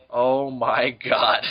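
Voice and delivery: slow, low reporter's dismay; lightly voiced appalled reporter. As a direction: draw it out as a stunned reporter. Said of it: "The reporter is just like".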